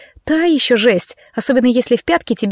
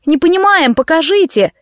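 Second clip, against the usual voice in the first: high-pitched feigned confusion; playing along; 7 semitones higher, 3 dB louder than usual. A narrator's reading. Cadets teasing the trainer to demonstrate, high mock plea. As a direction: plead high, as if clueless. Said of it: "Мы дружно подхватили игру".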